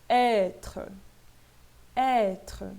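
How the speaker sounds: "Être" with open first vowel, final E barely sounded; silent final E. Shown as a demonstration. Teacher modelling for students, final E ignored. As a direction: stress the ê of "Être", let the ending fade. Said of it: "Esse último E não está acentuado".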